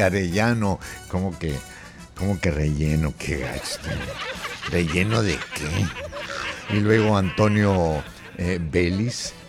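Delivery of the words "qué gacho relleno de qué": ordinary talk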